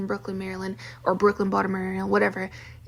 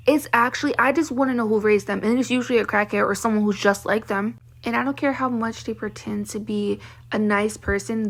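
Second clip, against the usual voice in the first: ordinary talk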